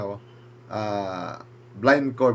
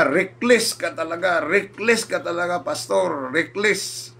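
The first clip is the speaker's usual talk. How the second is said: higher, scolding director, half-acted; scolding boss; 7 semitones higher, slower than usual. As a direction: retell the director scolding you sharply. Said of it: "pinagalitan pa ako". (galit na pagsesermon ng direktor)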